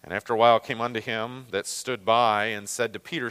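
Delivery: ordinary talk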